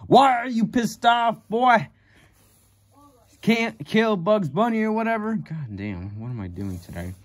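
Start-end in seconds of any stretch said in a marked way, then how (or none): 0.1-5.3 high, put-on cartoon cowboy voice, lightly done; mock Yosemite Sam. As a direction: tease him in a squeaky cartoon voice. Mocking the pissed-off cartoon cowboy.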